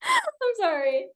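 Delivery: laughing sorry to the class. Real, not acted. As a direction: giggle out an apology.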